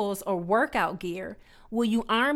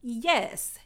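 ordinary talk, nothing marked

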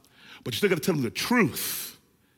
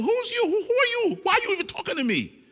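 much higher, scornful and quick; dismissive street attitude. Pretend. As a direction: wave off the devil as nobody. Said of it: "look at the devil and say".

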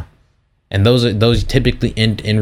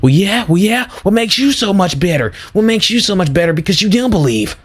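much higher, whiny mock of a preachy arguer; mocking defensive believer; 10 semitones higher than usual. Mocking defensive believers who snap back at doubters, high whiny voice. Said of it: "be all up in the mic talking about some".

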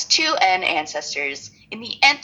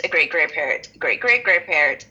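ordinary talk, nothing marked

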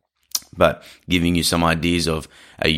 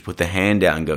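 ordinary delivery

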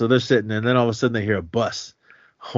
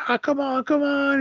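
high, pleading mock cheer; urging the horse on in a high voice; kid still cheering. Little C still rooting for the horse, high pleading cheer.

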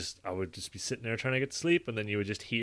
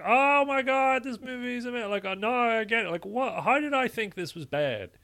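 much higher, gushing rave; delighted revelation; 13 semitones higher than usual. Retold or imitated. Rewatching a movie he once hated, high gushing amazement. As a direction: gush like it finally clicked.

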